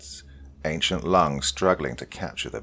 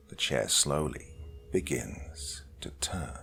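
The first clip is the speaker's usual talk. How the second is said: whispered; 2 semitones lower, 8 dB quieter than usual. A narrator's reading. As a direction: let it fade to a whisper. Chair turns, creeping horror.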